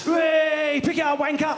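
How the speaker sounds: loud, high jeering shout; mock jeer. Brit tourist jeering a dropped glass, loud mock jeer. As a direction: jeer at the guy who dropped it.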